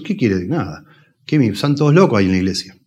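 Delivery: usual speaking voice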